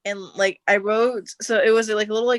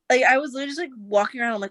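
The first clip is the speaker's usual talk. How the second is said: ordinary talk, nothing marked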